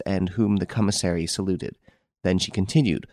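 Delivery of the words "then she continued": ordinary delivery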